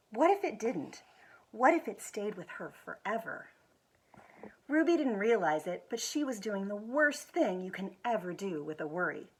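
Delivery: gentle, expressive picture book reading; reading to children. Acted. School foundation director reading a picture book to children, expressive storytelling. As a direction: tell it like bedtime story time.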